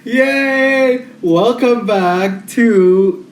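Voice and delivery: hyped podcast intro. Real, not acted. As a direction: stretch out a big, excited welcome.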